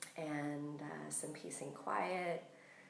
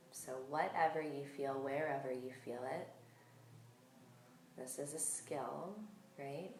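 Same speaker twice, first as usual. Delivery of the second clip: calm, unhurried meditation voice with long pauses; guided meditation; 5 dB quieter than usual. Real, not acted. Yoga teacher settling listeners into breath awareness, calm guided meditation.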